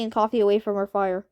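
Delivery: ordinary delivery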